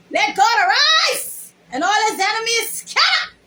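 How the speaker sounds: loud, high declaration of scripture; ringing church proclamation; preaching proclamation. Acted. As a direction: proclaim it like the pulpit.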